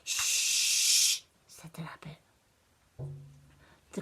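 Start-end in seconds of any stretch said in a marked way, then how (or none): 0.0-2.2 unvoiced shh and whisper. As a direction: hush her quickly. Rabbit hushes again.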